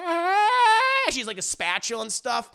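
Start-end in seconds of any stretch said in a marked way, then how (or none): 0.0-1.1 high, loud drawn-out "aah" wail; mock old lady's battle cry; elderly neighbor's shriek. Elderly neighbor bursts in with her spatula, mock high shriek. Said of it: "their elderly neighbor, and she's like".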